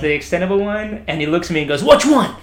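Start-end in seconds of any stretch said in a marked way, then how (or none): 1.8-2.3 loud, high shouted question; imitated eager cop, punchy and loud; retold cop's bark. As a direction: shout it like military police ready to swing.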